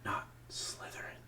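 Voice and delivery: soft, slow, desperate whisper; pleading whisper. Acted. Harry begs the hat in his head, a soft whisper. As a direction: beg silently under your breath.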